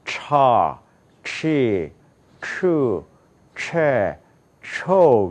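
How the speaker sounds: 차, 치, 추, 채, 초 with English ch, each falling; English CH drill. Shown as a demonstration. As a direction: model each of 차, 치, 추, 채, 초 separately as English ch syllables. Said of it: "초가 아니라 뭐냐면요".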